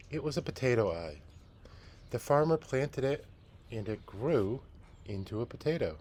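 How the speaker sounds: lively read-aloud storytelling voice; story narration. A narrator's reading. Teacher reading a picture book to the class, storytelling.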